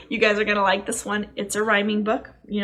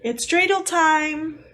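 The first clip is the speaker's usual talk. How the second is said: slow, higher and drawn out; excited announcement; 6 semitones higher, 4 dB louder, slower than usual. Real, not acted. Teacher announcing the story's dreidel time with glee. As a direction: savor the big moment. Reading the big moment.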